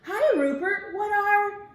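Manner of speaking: a small friend bursting in; high chirpy sidekick voice. Acted. Friend greets Rupert.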